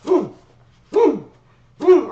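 high, loud booms like blows; shouted hit sounds. Retold or imitated. Retelling his dad's shoe strikes, shouted booms. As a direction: slam out each boom. Imitating hits.

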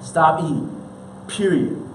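ordinary talk, nothing marked

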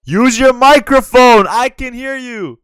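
loud, slow and insistent; loud demanding. Real, not acted. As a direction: yell the order slowly. Demanding stranger speak into mic.

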